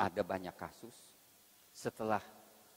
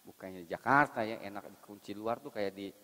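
ordinary talk, nothing marked

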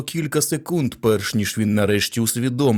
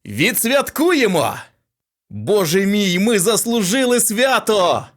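celebration; 10 semitones higher, 3 dB louder than usual. A narrator's reading. Celebrating success loudly.

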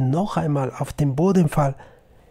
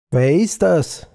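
calling out; 4 semitones higher, 6 dB louder than usual. Acted. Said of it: "rief Kilian".

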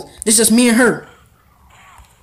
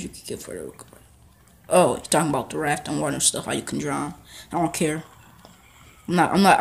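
normal talking voice

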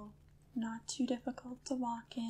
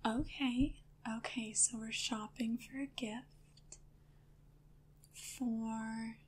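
gentle, hushed shop assistant role-play. Pretend.

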